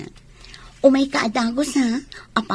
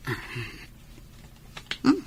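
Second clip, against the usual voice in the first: ordinary talk, nothing marked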